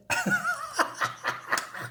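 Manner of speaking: high breathy laugh; high giggle. Real, not acted. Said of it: "I like that".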